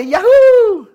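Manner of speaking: high, loud laughing whoop; cheering yahoo. Acted. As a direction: whoop with glee like a delighted husband. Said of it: "And the wives say".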